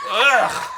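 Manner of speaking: loud, high groan of mock revulsion; disgusted groan. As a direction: groan out loud through laughter. Retold reaction to daughter's pickup line.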